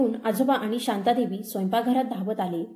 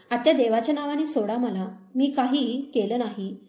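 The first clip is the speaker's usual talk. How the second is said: ordinary delivery, nothing marked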